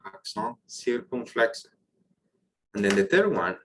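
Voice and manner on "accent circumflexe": circumflexe said slowly, the French way; slow French accent circumflexe. Shown as a demonstration. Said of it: "is gonna be called accent circumflexe".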